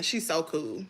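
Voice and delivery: hushed echo of the praise; soft repeat. Real, not acted. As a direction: drop to a quiet afterthought. Repeats praise, second time hushed.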